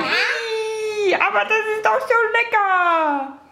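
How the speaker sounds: high squealing mock disgust; imitating dad. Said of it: "der würde sagen".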